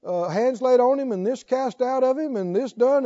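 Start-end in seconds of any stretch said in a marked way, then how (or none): none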